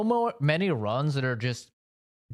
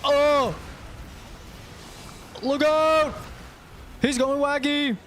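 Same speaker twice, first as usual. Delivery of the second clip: panicked yelling; 11 semitones higher than usual. Real, not acted. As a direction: yell in gaming panic. Panicked shouting at teammate.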